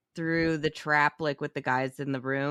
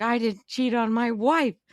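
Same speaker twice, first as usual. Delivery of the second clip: higher, slow, half-mocking protest; pleading Doctor Gordon; 7 semitones higher, 3 dB louder than usual. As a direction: plead your innocence a bit too hard. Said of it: "Doctor Gordon's like".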